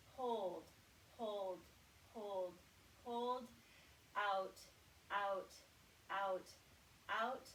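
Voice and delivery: slow, steady counted cues, one word at a time; guided breath counting. Shown as a demonstration. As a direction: pace their breathing like a coach. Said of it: "Here we go".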